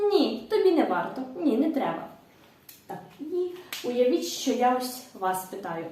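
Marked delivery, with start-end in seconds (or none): none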